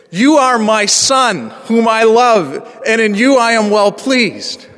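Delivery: the text spoken as a joyful declaration; glorious proclamation. Shown as a demonstration. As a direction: proclaim it like a father's blessing. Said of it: "This is a glorious thing to say".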